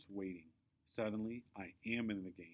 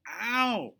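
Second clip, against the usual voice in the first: high, loud pained yelp; hurt bully screeching; 12 semitones higher, 11 dB louder than usual. Acted. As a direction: yelp as the kick lands. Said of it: "screeches Victoria".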